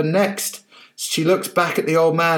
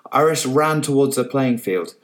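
usual speaking voice

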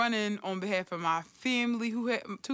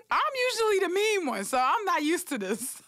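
high, playful complaint; mock surprise; 9 semitones higher, 3 dB louder than usual. Teasing her sister about role reversal, high and playful. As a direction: tease lightly, pitched up. Joking about being the mean one.

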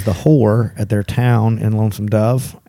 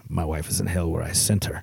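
put-on low, soft Mexican accent, like Zorro; quiet, dark reply in a Mexican accent; 4 semitones lower, 10 dB quieter than usual. Cook answers the captain about his wife, low Mexican accent. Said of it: "you sounded like Zorro right there".

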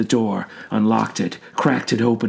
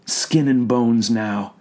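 plain reading voice

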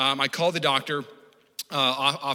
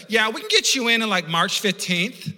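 receptionist; 7 semitones higher, 4 dB louder, slower than usual. Retold or imitated. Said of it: "Lay's like".

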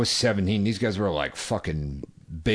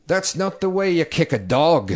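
higher-pitched, badly faked Irish accent; attempted Irish accent; 9 semitones higher, 4 dB louder than usual. Retelling an Irish guy in Galway, clumsy high Irish accent. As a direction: have another go at a poor Irish accent. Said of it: "I can't do an Irish accent".

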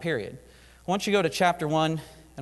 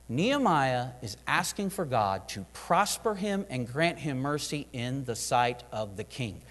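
ordinary delivery

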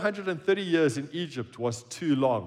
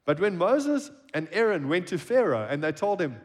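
ordinary delivery

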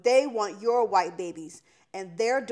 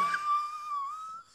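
squealing burst of laughter; high shrieking laugh; 3 dB quieter than usual. Real, not acted. Laughs at hip hop force.

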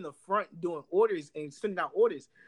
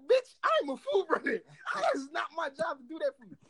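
high-pitched, acted-out objection; mock outraged comeback, pitched up; retold indignant retort; 9 semitones higher than usual. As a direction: reenact snapping back at a coworker. Acted quarrel with a coworker.

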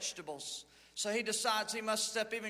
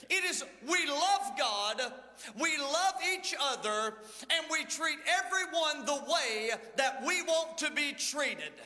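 lively raised pulpit preaching; 4 semitones higher than usual. Acted. Pastor preaching to his church about the middle road, fervent. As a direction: preach it out to the congregation.

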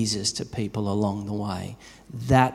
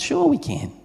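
cheerful yes; 11 semitones higher than usual. Retold or imitated. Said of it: "We said".

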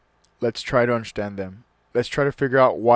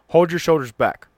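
higher, louder frowning command; stern father; 5 semitones higher, 8 dB louder than usual. Acted. Father correcting his son at the door, stern order. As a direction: bark it like a strict dad. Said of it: "I frowned and said in reply".

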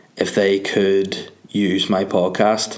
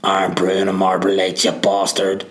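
lightly voiced local, slower and drawn-out; rough Northern Irish local; slower than usual. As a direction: put on a hint of a coarse local accent. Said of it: "They're more like".

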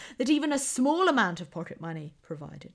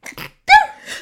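loud sudden sneeze; big real sneeze; sneezing; 18 dB louder than usual. Real, not acted. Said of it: "I'm going to sneeze".

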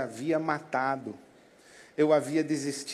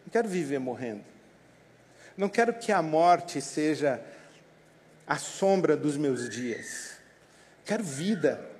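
ordinary talk, nothing marked

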